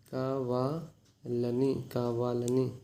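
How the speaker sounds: कावालनी stretched very slowly, syllable by syllable; slow teaching demo. Shown as a demonstration. Teacher slowing a Telugu word down for students. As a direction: drag कावालनी out so students catch it. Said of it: "जानबूझकर क्या बोलते हैं".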